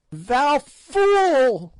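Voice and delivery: high, loud screeching hag; old fiend screaming an insult; fiendish shriek. A narrator's reading. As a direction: spit it out at him in a screech. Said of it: "shrieked the old fiend".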